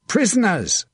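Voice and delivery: high-pitched battlefield cry, falling away; shouted call. A narrator's reading. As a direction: call it out high, like claiming captives. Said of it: "he shouted".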